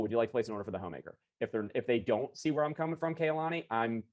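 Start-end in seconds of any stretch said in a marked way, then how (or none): 0.0-1.2 fast, run-together closing question; too fast. Shown as a demonstration. Salesman hurrying the order question at a customer, too fast. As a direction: rush the close in one breath. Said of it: "Don't say it that fast".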